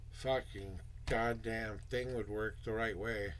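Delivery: ordinary talk, nothing marked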